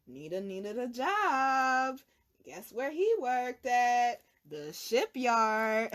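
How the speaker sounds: very slow, drawn-out teasing build-up; slow suspenseful storyteller tease; dramatic slow reveal. Real, not acted. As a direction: stretch each word to build suspense.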